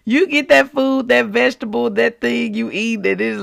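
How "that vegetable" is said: usual speaking voice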